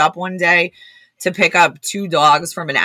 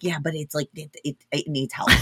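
meek plea; 9 dB quieter than usual. Retold or imitated. Acted plea to reluctant colleague.

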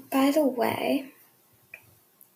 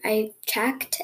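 ordinary delivery

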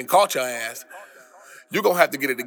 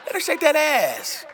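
excited cheering; 14 semitones higher than usual. Real, not acted. Cheering on a dancing woman.

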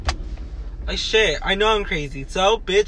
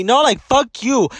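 slow, louder fuck you; angry dismissal; 5 semitones higher, 4 dB louder than usual. Real, not acted. To the sister playing victim, a slow angry fuck you. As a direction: spit it out at her.

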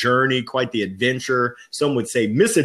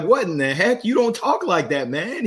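incredulous; 10 semitones higher than usual. Retold or imitated. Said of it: "He was like".

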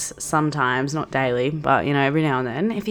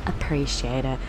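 low, softer, offhand reply; imitated curt reply; 5 semitones lower, 6 dB quieter than usual. Housemate replying in the group conversation, imitated low curt reply. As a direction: imitate him dropping it tersely. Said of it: "That was all he said".

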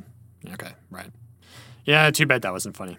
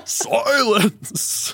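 much higher, drawn-out bellow; mock lion yell; 9 semitones higher than usual. Diner silencing the waiter, mock yelling. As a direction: theatrically shut someone down.

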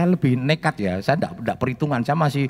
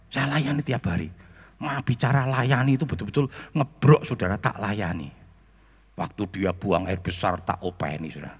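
usual speaking voice

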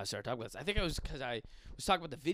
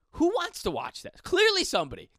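high, loud disbelief; worked-up incredulous rant; 8 dB louder than usual. Real, not acted. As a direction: exclaim in baffled outrage. Incredulous rant at porn ads.

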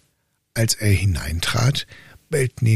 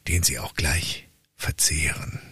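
hushed, softer warning; ominous whisper; 5 semitones lower, 5 dB quieter than usual. Acted. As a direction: whisper the danger like a secret. Lord warns of deadly dogs.

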